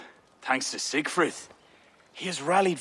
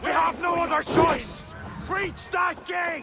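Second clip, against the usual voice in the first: battle shout. Acted. King ordering his warriors to breach the gate, full battle shout. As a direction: roar the order over the fighting.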